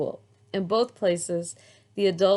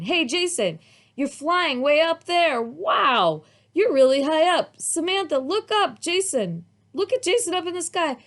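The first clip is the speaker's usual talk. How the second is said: high, loud excited calling up to a friend; child shouting up at the sky; 9 semitones higher, 5 dB louder than usual. A narrator's reading. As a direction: cheer him on, way up there.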